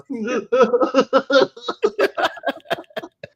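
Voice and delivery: long high burst of laughter; bright giggling laugh that falls away. Real, not acted. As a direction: laugh helplessly at yourself. Cracking up at the memory.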